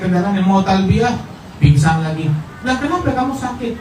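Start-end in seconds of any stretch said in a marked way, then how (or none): 2.6-3.7 a high, softer worried asker; high, soft voiced questioner. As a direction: someone asks the fainted imam why, high and soft. Someone asks the fainting imam.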